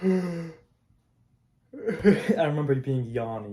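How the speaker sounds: laughing through the words; chuckling at the memory while speaking. Real, not acted. Vlogger laughing at his younger self, real laughter.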